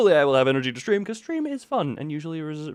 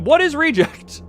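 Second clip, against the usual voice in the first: baffled; 11 semitones higher, 6 dB louder than usual. Real, not acted. Confused at chat.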